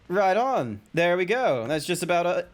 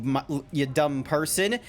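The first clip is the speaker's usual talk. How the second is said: normal talking voice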